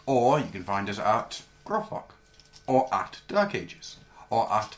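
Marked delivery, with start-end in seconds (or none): none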